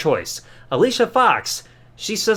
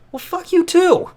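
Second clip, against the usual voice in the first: a slow, much higher offended comeback; indignant high retort; 12 semitones higher, 3 dB louder than usual. Real, not acted. Reviewer snapping back at Daniel Bryan's answer, high and offended. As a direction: fire it back, mock hurt and high.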